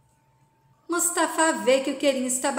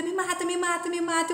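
mock yell; 6 semitones higher, faster than usual. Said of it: "e grita".

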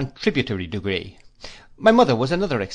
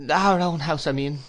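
higher, anxious self-correction; frightened faltering; 6 semitones higher than usual. A narrator's reading. Mother corrects herself before her husband, frightened. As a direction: back down nervously. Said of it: "faltered my mother, evidently frightened".

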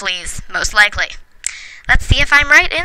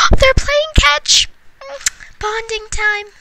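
much higher, slow cooing squeal; squealing over cuteness; 13 semitones higher, slower than usual. Real, not acted. Gushing as the father and son play catch, high coo. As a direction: coo at them like they're adorable.

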